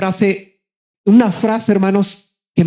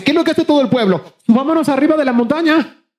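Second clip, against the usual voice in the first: townsfolk voice; 7 semitones higher, faster than usual. Retold or imitated.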